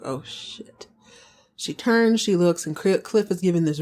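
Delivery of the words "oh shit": soft unvoiced whisper of dismay; whispered oh shit. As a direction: breathe it out, caught in a lie. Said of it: "She's like".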